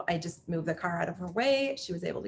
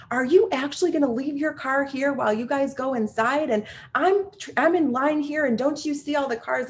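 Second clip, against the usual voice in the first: higher, indignant mock complaint; imitating her huffy outburst; angry woman's rant; 7 semitones higher than usual. Mother at the pump to the stranger, a mocked angry rant.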